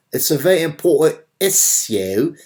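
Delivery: hammed-up posh British accent mocking John Oliver. Commentator mocks a British talk show host, in a put-on British accent. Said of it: "British John Oliver".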